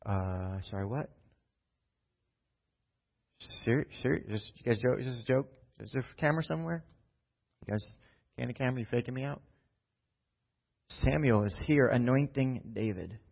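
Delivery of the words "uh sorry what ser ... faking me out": soft, quiet flustered questions; he can't believe it's real; quietly stammering, searching for a hidden camera; stunned disbelief. Acted. David stunned at anointing.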